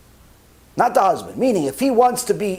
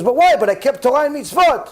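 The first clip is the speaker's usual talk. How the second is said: higher, louder pleading woman; desperate plea; 6 semitones higher, 3 dB louder than usual. Retold or imitated. Dying woman pleading with heaven, desperate. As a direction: beg for your life before the court.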